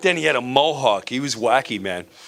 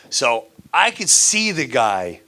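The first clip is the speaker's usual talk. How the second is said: usual speaking voice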